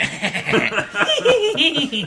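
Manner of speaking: high-pitched mocking cackle; imitated douchebag laugh. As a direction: mimic a jerk's gloating laugh. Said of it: "I love his douchebag laugh".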